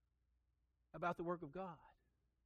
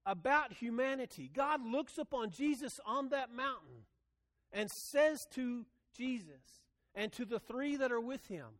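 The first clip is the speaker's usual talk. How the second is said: rising, fervent sermon voice; full pulpit cadence; preaching; 4 semitones higher than usual. Acted. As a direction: preach it out to the pews. Preaching to congregation.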